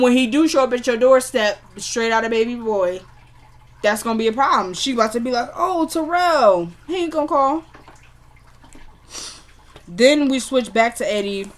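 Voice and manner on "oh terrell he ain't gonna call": lightly acted higher-pitched complaint; mock whiny reaction. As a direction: draw out the name in a higher mock voice. Imagines her reacting to Terrell.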